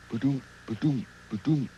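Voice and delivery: soft, low ba-doom heartbeat; gentle mock heartbeat; heartbeat sound. Shown as a demonstration. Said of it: "That's the sound your heart makes".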